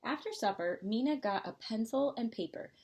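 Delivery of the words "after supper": ordinary delivery, nothing marked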